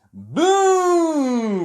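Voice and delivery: very loud, high "Boo!"; loud shout. A narrator's reading. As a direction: leap out and shout it. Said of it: "a loud voice shouted".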